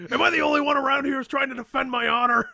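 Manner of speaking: hammed-up wounded indignation; indignant rant. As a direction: protest loudly on your own behalf. Offended hero's mock outraged rant.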